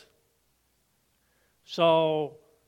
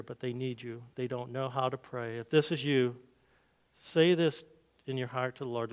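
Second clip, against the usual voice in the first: ordinary delivery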